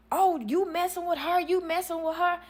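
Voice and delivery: high-pitched imitation of shocked women; hammed-up indignant confrontation; mock accusation. Retold women confronting each other.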